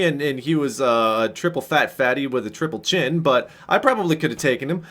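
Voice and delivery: ordinary talk, nothing marked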